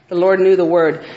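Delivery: ordinary talk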